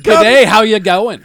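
loud mock Australian greeting; Australian accent. Shown as a demonstration. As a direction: show off a broad Australian accent. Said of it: "here, try".

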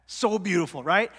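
ordinary talk, nothing marked

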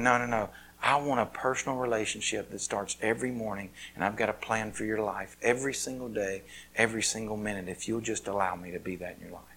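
low, soft voice of God; God's gentle voice. Acted. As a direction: speak as God, quietly and steadily. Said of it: "And God's going".

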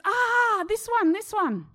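high, loud excited find; suddenly light up at an idea; excited discovery. Retold or imitated. Hairdresser finds the photo.

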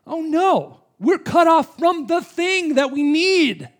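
impassioned sermon climax; fervent preaching. Acted. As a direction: press the need on them, rising.

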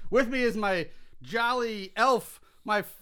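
big booming showman intro, high and loud; hype host voice. Acted. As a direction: shout it like a wild holiday emcee.

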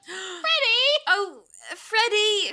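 high, loud cry of a name, then drawn out; alarmed calling out. Acted. Calling to a friend who fell downstairs, loud mock dismay.

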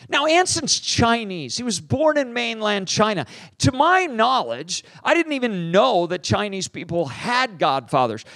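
normal talking voice

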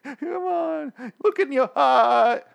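high, whining mock plea, words drawn out; hammed-up begging for his life, pitch way up; pleading. Dwight begs not to be killed in the woods, hammed-up pleading. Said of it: "then he starts pleading".

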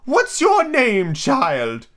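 high, loud and slow, dropping at the end; imperious Queen. A narrator's reading. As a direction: question a child as a haughty queen. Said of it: "and she went on".

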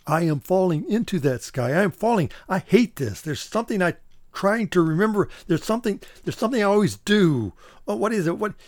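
clutching for the memory in a free fall; panicked falling dream. Acted.

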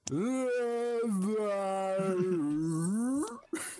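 one long imitated wail, rising late; long nonsense wail. Imitating Frankie's audition in place of Shakespeare, long swooping noise.

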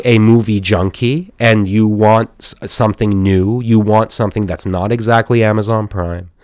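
usual speaking voice

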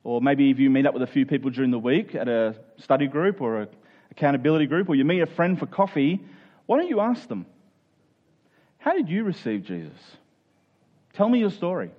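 ordinary talk, nothing marked

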